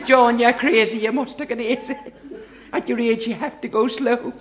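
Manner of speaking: lightly voiced scolding wife. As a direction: lightly play the wife chiding him. Wife scolding husband in verse.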